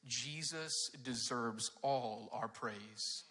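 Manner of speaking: "Jesus deserves all our praise." drawn out very slowly, with weight on "all"; slow emphatic. Shown as a demonstration. Pastor giving the kids the bottom line, slow and emphatic. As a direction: lean on every word of the main point. Stating the main point.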